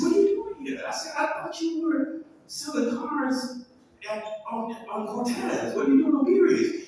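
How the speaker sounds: put-on high, delighted surprise at an old friend; light up at seeing Corey here; happily surprised to find his old friend selling cars; surprised greeting.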